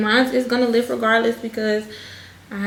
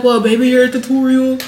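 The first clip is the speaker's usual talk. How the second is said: mock viewer voice; 2 semitones higher, 5 dB louder than usual. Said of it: "y'all gonna be like".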